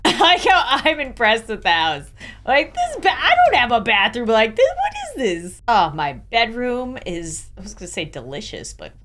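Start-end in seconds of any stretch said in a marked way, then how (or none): 4.7-5.6 sudden high squeak of disbelief; surprised. Real, not acted. Player gawking at a luxury bathroom, high squeak. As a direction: shoot way up in pitch at the sight.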